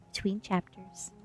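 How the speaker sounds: usual speaking voice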